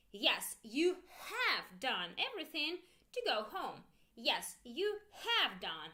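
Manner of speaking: "have" drawn out and stressed; teacher modelling emphatic HAVE. Shown as a demonstration. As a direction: lean hard on "have". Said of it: "Repeat with me with intonation".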